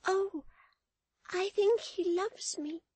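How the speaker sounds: put-on very high, loud, slow child voice; wildly crying child. A narrator's reading. Florence pleads to nurse about her brother, wild child cry. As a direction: cry out as a pleading little girl. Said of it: "cried the child wildly".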